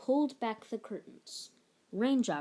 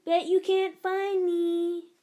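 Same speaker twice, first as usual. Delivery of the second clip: high, slow, loud teasing call; teasing hide-and-seek; 9 semitones higher, 7 dB louder, slower than usual. Pretend. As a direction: tease your friend from the hiding place. Hiding child teases.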